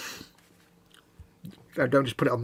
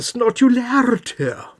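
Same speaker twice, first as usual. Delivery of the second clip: stuffy nasal voice; blocked nose; 9 semitones higher, 6 dB louder, slower than usual. Real, not acted. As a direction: talk through a blocked nose. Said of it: "really snotty nose today".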